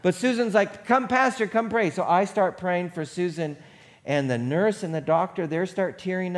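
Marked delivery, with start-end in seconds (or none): 0.9-1.9 eager calling out. Retold or imitated. Patient insists pastor come in.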